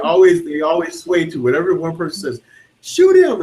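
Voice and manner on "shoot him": high, loud mock mob yell; hammed-up angry crowd.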